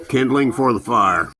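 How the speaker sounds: normal talking voice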